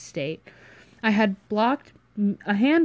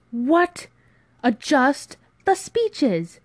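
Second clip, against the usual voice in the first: high, loud, drawn-out disbelief; incredulous outburst; 7 semitones higher, 5 dB louder, much slower than usual. Acted. Friend repeats the proposal back, incredulous. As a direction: echo it slowly, appalled.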